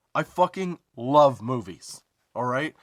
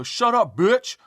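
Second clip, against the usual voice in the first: high, louder taunting yell; mock yell; 8 semitones higher, 4 dB louder, much slower than usual. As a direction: throw it back like a rude teen heckler. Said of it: "these motherfuckers turn and go".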